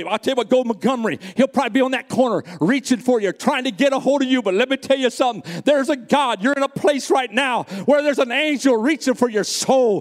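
preacher's voice climbing to full force; building preaching. Real, not acted. Preacher telling the church an angel is reaching, building preaching. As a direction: drive it upward into a fervent proclamation.

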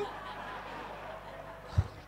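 breathy laugh at own joke; amused laugh. Real, not acted. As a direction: laugh softly at your own story. Joking about staged handbag rescue.

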